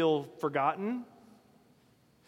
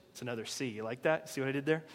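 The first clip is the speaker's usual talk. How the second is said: quiet aside; 6 semitones lower, 7 dB quieter, faster than usual. Real, not acted. Aside about his pun.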